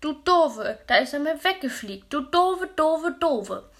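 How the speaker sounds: put-on a small child screaming insults through tears; sobbing angry child. A narrator's reading. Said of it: "hat Maus geschluchzt".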